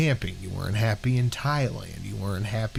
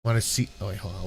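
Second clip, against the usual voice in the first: normal talking voice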